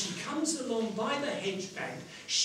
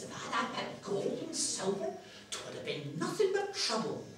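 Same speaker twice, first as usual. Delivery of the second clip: put-on high, softer old countrywoman voice; old woman; 7 semitones higher, 4 dB quieter than usual. Washerwoman decides gold would be trouble, high old-woman voice. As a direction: reason it out cheerfully in an old crone's voice. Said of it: "how old and worn out she is".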